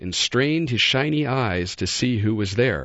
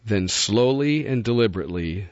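usual speaking voice

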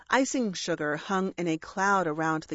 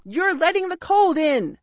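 high, loud parrot bawl; 9 semitones higher, 6 dB louder than usual. A narrator's reading. Parrot cutting off the dragon's speech, bawling. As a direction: bawl over the other's speech. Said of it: "Bertie bawled".

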